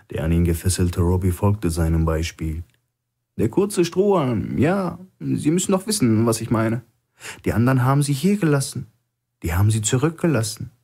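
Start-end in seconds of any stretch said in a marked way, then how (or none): 3.4-10.7 higher, cool and knowing, quickening at the end; a captive calmly needling his guard; lightly raised voice of a sly hostage; Stone, calm hostage. Acted.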